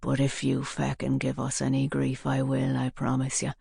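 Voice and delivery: low hoarse quiet menace; hoarse threat. A narrator's reading. Gunman threatening Katie, quiet hoarse menace. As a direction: lean in and rasp it softly.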